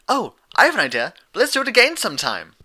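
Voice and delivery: high, loud and fast; bubbly Pinkie. Acted. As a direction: rattle it off with glee. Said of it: "Pinkie concluded".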